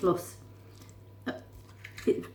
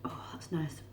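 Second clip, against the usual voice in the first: whispering; 9 dB quieter, slower than usual. Real, not acted. Savouring food while eating.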